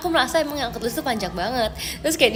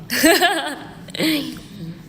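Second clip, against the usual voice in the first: light giggling laugh; amused giggle; 3 semitones higher than usual. Real, not acted. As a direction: laugh it off in a little burst. Laughs after bonus song remark.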